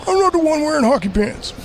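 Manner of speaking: high, hammed-up quote of a favorite movie line. Host quoting his favorite Dark Knight line, hammed up. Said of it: "my favorite line from The Dark Knight".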